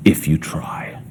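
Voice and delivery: breathy mocking whisper; hushed taunt to a child. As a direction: drop to a whisper for the condition. Whispered end of cruel parent quote.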